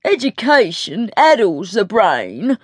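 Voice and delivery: slow, swooping affected sneer; pompous footman mocking a maid; jeering. A narrator's reading. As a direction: pronounce it with mincing scorn. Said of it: "jeered Joseph".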